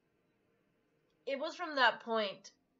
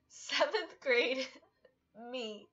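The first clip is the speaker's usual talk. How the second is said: choked-up words broken by teary laughs; fighting back happy tears; real happy crying, high and breaking; tearful, laughing through tears; 4 semitones higher, slower than usual. Real, not acted. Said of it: "I'm crying".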